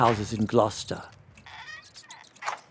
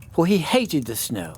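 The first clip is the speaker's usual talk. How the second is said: ordinary delivery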